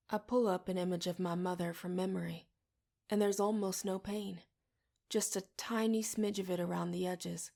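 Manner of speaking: usual speaking voice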